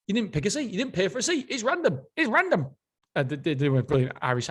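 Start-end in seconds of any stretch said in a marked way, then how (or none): 0.1-1.5 higher, scoffing boss voice; hammed-up dismissive tycoon; mocking the boss. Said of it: "And he's like". 1.5-2.0 higher, scoffing "random"; mock dismissive "It's random"; mocking the boss. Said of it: "And he's like". 2.2-2.7 hammed-up peak of the scoffing boss; mocking the boss, building. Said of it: "And he's like".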